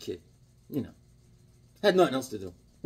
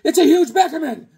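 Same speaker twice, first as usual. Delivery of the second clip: very high, hammed-up shriek; squealing crowd alarm; panicked onlookers; 14 semitones higher, 6 dB louder than usual. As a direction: shout in mock terror at a giant. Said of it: "People are like".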